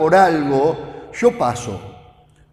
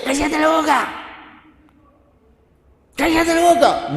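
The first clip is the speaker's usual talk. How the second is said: high-pitched, sharp scolding; regaño de los discípulos; 8 semitones higher than usual. Acted. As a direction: order him to shut up, pitch jumping up high. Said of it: "los discípulos retaban al ciego". (regañando a gritos)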